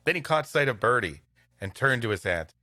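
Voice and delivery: ordinary delivery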